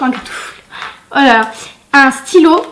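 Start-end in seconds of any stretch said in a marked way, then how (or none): 0.0-0.5 breathy whisper, almost to herself; whispered aside. Real, not acted. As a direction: drop to a whisper under her breath. Repeats the word, then whispers it. (chuchoté pour soi, dans un souffle)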